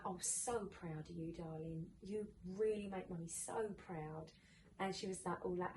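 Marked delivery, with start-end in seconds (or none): 0.1-4.3 put-on soft, slow, loving words to a child; retelling her praise to her daughter, soft and tender. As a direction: gently tell your little girl she's done well. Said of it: "I was like".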